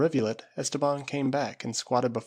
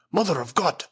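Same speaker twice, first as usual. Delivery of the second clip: startled cry of dread; cried out; 5 semitones higher, 4 dB louder than usual. A narrator's reading. Esteban seeing a ghost by the fire, crying out in fear. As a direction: gasp it out in shock. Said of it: "he cried".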